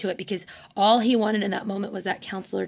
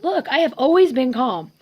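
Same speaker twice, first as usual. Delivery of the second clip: higher, louder defensive outburst, retold; exasperated protest; 4 semitones higher, 3 dB louder than usual. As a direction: push back, upset, as in the argument. Acted quarrel with the counselor.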